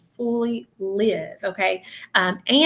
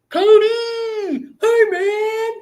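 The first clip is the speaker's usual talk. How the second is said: much higher, loud shouted greeting to a buddy; young guy calling out; 14 semitones higher, 7 dB louder, much slower than usual. Retold or imitated. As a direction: holler across the yard at your friend. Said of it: "let me tell you how he did it".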